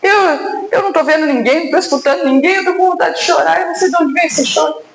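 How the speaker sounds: crying and anguished. Retold or imitated. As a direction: sob it out, confused why the tears come. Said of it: "a irmã que estava chorando e angustiada".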